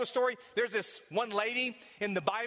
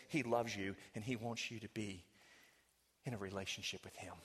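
low, hushed pastoral voice, drawing the listener close; quiet tender sermon passage; soft intimate preaching; 8 semitones lower, 4 dB quieter than usual. Acted. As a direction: lean in and speak tenderly to the lost.

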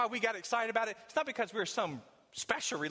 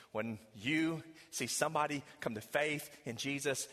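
ordinary talk, nothing marked

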